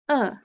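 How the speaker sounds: "uh" said alone as a short oo of hook; isolated sound. Shown as a demonstration. Teacher modelling the oo of hook for kids. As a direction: give just the short "uh" sound. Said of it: "Digraph O O".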